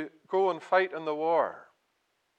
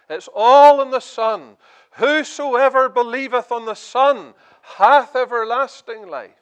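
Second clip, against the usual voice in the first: rising, loud, higher pleas building to the end; preaching at full force; 5 semitones higher, 8 dB louder than usual. Acted. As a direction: drive each question home like a revival preacher. Preacher builds altar call.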